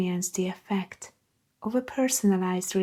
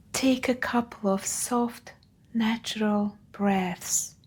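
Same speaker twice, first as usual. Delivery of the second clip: guided meditation. Acted. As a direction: guide them gently, leaving room after each phrase.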